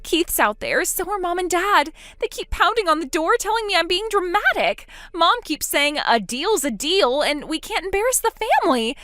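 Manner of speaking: a trapped sister begging for help on the phone; panicked younger sister. Acted. Molly continues, frightened.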